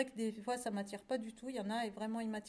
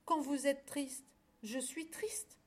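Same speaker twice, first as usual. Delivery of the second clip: lightly voiced lamb, higher pitch; sincere little lamb; 5 semitones higher than usual. A narrator's reading. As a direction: explain friendship to a dear friend. Lamb reassures his friend. (petit agneau sincère)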